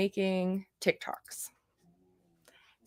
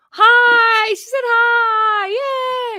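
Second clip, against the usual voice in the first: high, loud excited hello; excited squeal; 12 semitones higher, 7 dB louder, much slower than usual. Real, not acted. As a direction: squeal it with delight. Excited greeting at art auction.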